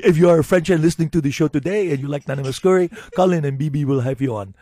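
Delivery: loud comic French radio plea; hammed-up French, projected; French accent. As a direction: lay on a mock French accent. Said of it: "start speaking in a French accent".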